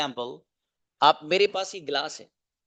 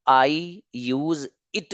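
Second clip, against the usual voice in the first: stretched slowly, word by word; slow model sentence; much slower than usual. Shown as a demonstration. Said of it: "teacher models sentence slowly". (धीरे-धीरे model वाक्य)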